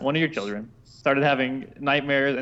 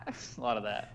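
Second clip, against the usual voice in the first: normal talking voice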